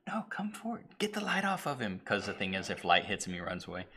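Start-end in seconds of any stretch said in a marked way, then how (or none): 0.1-2.0 urgent high whisper. Retold or imitated. Homeless guy urging the cameraman forward, whispering. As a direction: hiss it so the creature won't hear. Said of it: "the guy's like".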